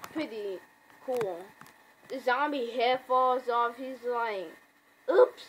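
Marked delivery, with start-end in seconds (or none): 5.1-5.4 loud, high-pitched little yelp; mock startled. Comic fan voicing Rick as the head falls off, mock startled yelp. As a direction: blurt it as a startled reaction.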